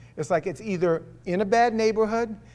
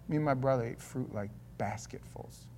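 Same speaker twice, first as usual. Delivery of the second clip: ordinary delivery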